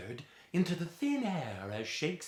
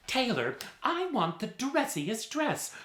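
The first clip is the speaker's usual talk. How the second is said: lady customer; 12 semitones higher than usual. Acted. Ladies demand the dressiest dress, in a high voice. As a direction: pitch way up like a fussy lady.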